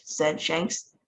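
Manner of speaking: ordinary delivery, nothing marked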